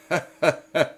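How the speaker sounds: short crazed laugh; mad cackle. Acted. The raving king laughs madly mid-delirium. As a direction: laugh like a madman. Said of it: "parmi des éclats de rire".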